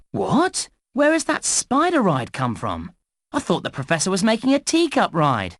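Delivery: high-pitched puzzled surprise; startled, baffled tutorial guide, pitch jumping up; surprised. Acted. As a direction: react as if something unexpected just turned up. Startled by an unexpected ride.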